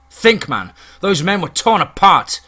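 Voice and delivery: loud, high, urgent protest; shouted appeal to see sense; shouting. A narrator's reading. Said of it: "He shouts".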